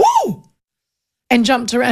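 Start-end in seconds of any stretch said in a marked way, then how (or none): 0.0-0.4 high startled whoa; startled whoa from the crowd; crowd gasp. Retold or imitated. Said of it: "everyone went".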